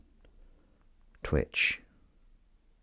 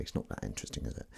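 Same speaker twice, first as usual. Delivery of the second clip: whispering; 13 semitones lower, 18 dB quieter, faster than usual. Acted. Whispered self-answer aside.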